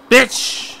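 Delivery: high, sharp angry cry; angry outburst. Real, not acted. Gamer cursing the puzzle with a high angry cry. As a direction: blurt it in frustration. Puzzle attempt fails again.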